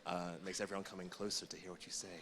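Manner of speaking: soft, close to the mic; hushed, right in your ear; whispering into the mic. Real, not acted. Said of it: "So I'm gonna whisper into the microphone".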